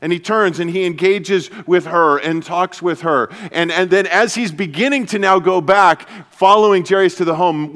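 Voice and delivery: normal talking voice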